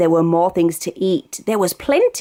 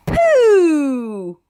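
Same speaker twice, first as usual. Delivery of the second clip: comic reveal; 6 semitones higher than usual. A narrator's reading. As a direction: land the big silly word with relish. Punchline of the story.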